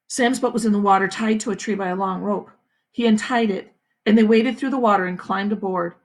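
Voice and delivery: usual speaking voice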